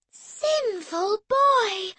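high, very slow saintly scolding; pious reproach. Acted. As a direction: sanctimoniously scold her brother. Emily pious, sugary tone.

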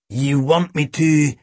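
slow henchman's offer; thuggish sidekick. A narrator's reading. Boyle offering to deal with Tim, thuggish. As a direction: offer violence slowly, in a thug's voice.

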